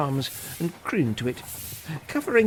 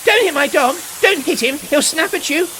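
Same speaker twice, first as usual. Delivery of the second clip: loud and much higher, protective alarm; shouting; 11 semitones higher, 6 dB louder than usual. Acted. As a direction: yell to stop the man hitting her dog. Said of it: "shouted George".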